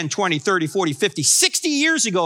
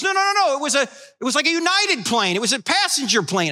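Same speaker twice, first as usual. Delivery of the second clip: higher, urgent pastor shouting news; alarmed voice on the phone; excited yell; 8 semitones higher than usual. Retold or imitated. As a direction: retell the shocked pastor, much higher.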